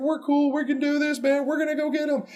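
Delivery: brash soldiers bragging; high, cocky taunt. Acted.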